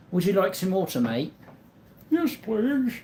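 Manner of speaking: high-pitched mock British accent. Offering a mate some water, mock British accent. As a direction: put on a hammed-up, high British voice. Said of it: "Best British accent".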